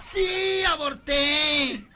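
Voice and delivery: high, loud wail easing into lament; melodramatic insistence. Acted. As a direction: insist in a campy, stretched-out cry. Personaje insiste melodramático en su aborto. (lamento agudo y melodramático)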